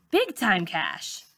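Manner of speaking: ordinary delivery, nothing marked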